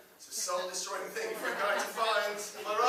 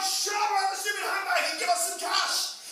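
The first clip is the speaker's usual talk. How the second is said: louder, higher yelled demand; hammed-up furious yell; mock angry shout; 10 semitones higher, 8 dB louder than usual. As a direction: shout him down and demand cash. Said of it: "They'd be like".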